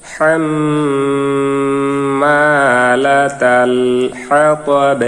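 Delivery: হাতাব ending on a bouncing stopped ব, after slow chanted হাম্মালাতাল; qalqala stop. Shown as a demonstration. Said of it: "বাটি কলকলা উচ্চারণ হবে".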